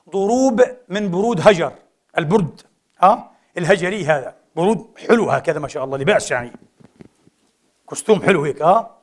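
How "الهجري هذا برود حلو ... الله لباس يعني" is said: ordinary delivery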